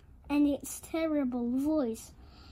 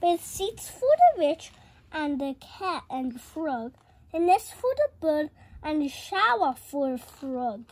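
ordinary delivery

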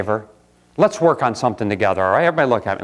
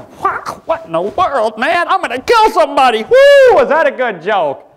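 high, loud hammed-up rage; mock emotional outburst; 13 semitones higher, 5 dB louder than usual. Preacher acts out his own emotional outburst for the church, in a hammed-up high shout. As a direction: explode like a hothead, then whoop. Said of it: "I mean, I".

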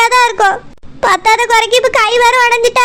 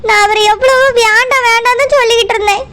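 usual speaking voice

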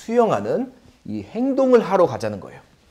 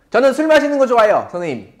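student's confession; 8 semitones higher, 6 dB louder, faster than usual. Retold or imitated. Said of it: "어떤 분들이 그래요".

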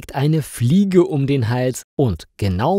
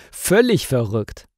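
ordinary delivery, nothing marked

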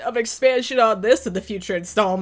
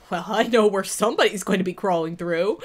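ordinary talk

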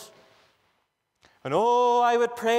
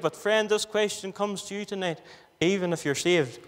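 normal talking voice

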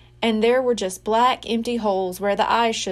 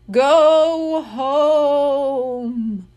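slow, louder drawn-out ghost moan; ghostly moan; 5 semitones higher, 5 dB louder, much slower than usual. Acted. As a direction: stretch every word like a haunting spirit. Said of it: "the ghost moaned".

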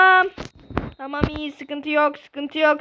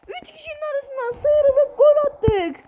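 excited commentary; 10 semitones higher than usual. Real, not acted. Goal replay commentary.

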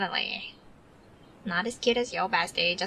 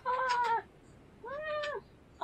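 high surprised yelp; 6 dB quieter than usual. Real, not acted. Startled by the dog.

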